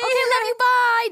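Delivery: squeaky high sweet farewell; high mock goodbye. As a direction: pitch it way up, sing-song.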